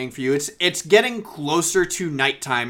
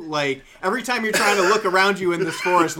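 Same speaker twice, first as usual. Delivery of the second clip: ordinary talk, nothing marked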